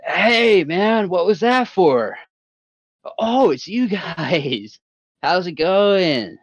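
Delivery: put-on high, slow woozy drunk voice; just woken, slurring a bit; drowsy drunk guy. Groggy Derek voiced by GM.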